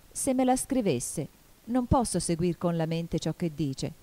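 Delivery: plain reading voice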